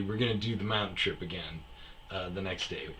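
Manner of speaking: ordinary talk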